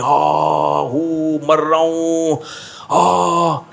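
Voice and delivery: hammed-up groans of a hurt man; mock moaning. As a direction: moan like you're dying, overdone. Injured man moaning, faking agony. (नाटकीय कराहती आवाज़ में)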